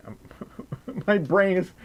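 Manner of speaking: usual speaking voice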